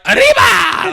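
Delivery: high, loud "Arriba!" shout; over-the-top Spanish-accented holler; hammed-up Mexican cry. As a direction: let loose a festive mock-Mexican yell. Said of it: "so close to being like".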